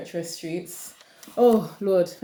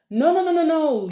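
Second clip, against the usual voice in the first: high, louder string of protests; indignant refusal; 7 semitones higher, 4 dB louder than usual. Acted. Woman cutting off a cheater's tears, indignant. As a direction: cut them off, high and indignant.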